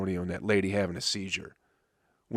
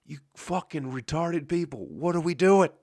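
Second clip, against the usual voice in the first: higher, put-on voice of a fed-up comic; scoffing at the crowd, pitched up in disbelief; mock exasperated rant; 6 semitones higher than usual. Mocking the rowdy crowd.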